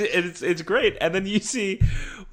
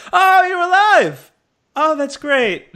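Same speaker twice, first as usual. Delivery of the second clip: imitated high, loud, drawn-out amazement; hammed-up relief, slow and very high; delighted surprise; 12 semitones higher, 6 dB louder, slower than usual. Korg finding the friend alive, delighted surprise. Retold joy at friend reviving.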